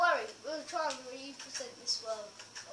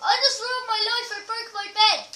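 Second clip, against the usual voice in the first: high, loud lament; loud wail; 7 semitones higher, 9 dB louder than usual. Real, not acted. Player who broke the bed, wailing lament. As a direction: cry out as if all is lost.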